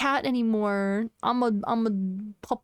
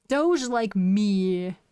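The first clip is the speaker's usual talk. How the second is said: drawn out; much slower than usual. Real, not acted. Drawn-out self-naming.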